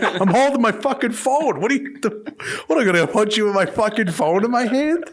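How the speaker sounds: mock outrage at a silly accusation; exasperated retort. Bailiff snapping back at the old traveler, mock exasperated shout.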